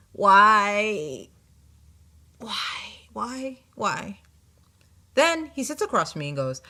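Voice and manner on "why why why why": despairing whine. Retold or imitated. Despairing as the man sits across from her.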